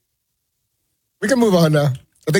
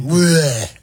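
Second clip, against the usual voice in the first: lightly imitated sportscaster grunt; Chris Berman. Host capping his attempted sportscaster bit, light impersonation. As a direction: throw out a mock catchphrase grunt. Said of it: "I'm trying to do the impersonation".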